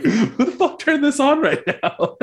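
high, drawn-out indignant complaint; high-pitched joke indignation; mock outrage. Voicing the AC in a joke.

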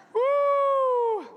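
high shouted woo; loud whoop. Real, not acted. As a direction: let out a joyful whoop. Preacher whoops to congregation.

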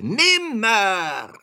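very high, loud raven screech; piercing raven's cry; croaking raven. A narrator's reading. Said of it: "krächzte da der Rabe".

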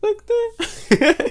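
high laughing question; laughing disbelief. Real, not acted. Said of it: "laughing disbelief".

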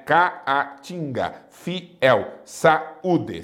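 "Caatinga, fiel, saúde" broken between the two vowels; syllable by syllable. Shown as a demonstration. Teacher showing students where hiatuses split, syllable by syllable. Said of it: "Separam-se as vogais dos hiatos".

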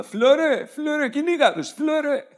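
hammed-up shrill rallying call; mock rallying cry. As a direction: cheer him on like a panicked fan. Mock cheering the general.

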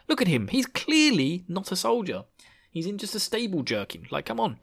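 high-pitched mock disbelief; mock scoffing. Onlookers judging the supposed soldier, mock scoffing. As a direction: scoff as an unimpressed bystander.

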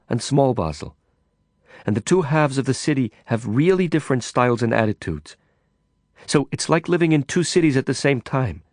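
a foreign woman remembering her city fondly; Carla, wistful. Acted. Carla's voice held for many lines.

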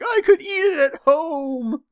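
high, slow, wavering guilt trip; hammed-up teary sigh; mock tearful martyr. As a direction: play the wounded mother, loudly. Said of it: "As tears streamed down her face".

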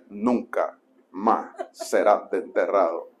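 deep, soft, drawn out word by word; mock-solemn sermon title. Novice preacher answering friends with the same sermon, mock-solemn. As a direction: announce it gravely with long pauses.

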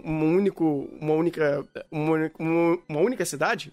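words catching in the throat; choking mid-sentence, stumbling. Real, not acted. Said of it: "meu Deus, engasguei".

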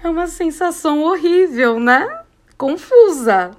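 high, loud theatrical anguish; hammed-up confused wail; mock despair. Real, not acted. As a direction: ham up the awful feeling. Dramatizing her mixed feelings.

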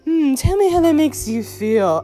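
slow, high, loud therapist parody; mock therapist. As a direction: play a therapist with exaggerated, drawn-out concern. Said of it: "this as a therapist".

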